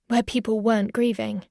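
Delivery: ordinary delivery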